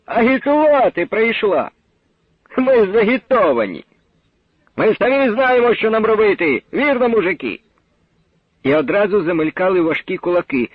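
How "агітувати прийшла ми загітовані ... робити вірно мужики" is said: high mocking yell at the speaker; jeering shout. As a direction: heckle her off the stage. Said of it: "закричав".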